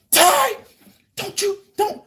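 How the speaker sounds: very high-pitched, halting mock shock; imitating someone aghast, voice shot way up; shocked objection. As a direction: squeak out a scandalized objection. Acting shocked administrators' reaction.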